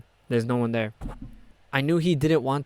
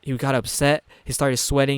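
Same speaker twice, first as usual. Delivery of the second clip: ordinary delivery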